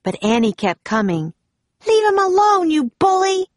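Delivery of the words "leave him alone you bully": put-on high, loud girl's yell; indignant cry. A narrator's reading. Annie defends Jack from the pirate, crying out shrilly. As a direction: cry out at a bully, pitched very high. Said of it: "she cried".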